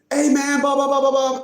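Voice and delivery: high, monotone blah-blah of a rambler; retold client's spiel. Client rambling on to his lawyer, high mocking imitation. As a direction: mimic a droning caller in a high voice. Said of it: "He's telling me".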